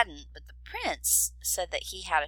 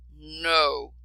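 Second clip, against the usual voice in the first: growled; 3 semitones lower, 6 dB louder than usual. A narrator's reading. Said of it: "growled the dragon".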